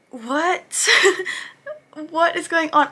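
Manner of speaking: high, loud what with a laugh; high, loud baffled outburst and laugh; shocked disbelief. Real, not acted. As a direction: react as if you can't believe it. Shocked by the attack scene.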